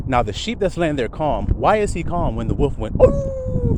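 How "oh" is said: a squeaky yelp way up high. Acted. Wolf being bonked by the shepherd, high yelp. Wolf's cry when struck.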